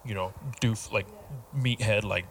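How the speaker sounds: low, quiet meathead monotone; monotone man voice. Shown as a demonstration. Friend showing his regular tone, low meathead monotone. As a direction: sink into a dopey, level man voice.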